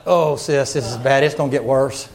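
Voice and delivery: low mock-gloomy aside to a sister; mock fretting. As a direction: imitate a nervous churchgoer. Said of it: "don't like".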